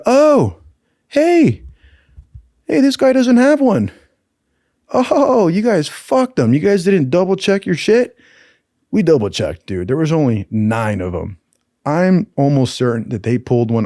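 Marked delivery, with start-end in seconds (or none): 0.1-8.2 high, loud, sneering instructor calling out the missing kit; scolding the team like an outraged instructor; mocking instructor. Instructor to the team, high mocking scold. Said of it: "and they go".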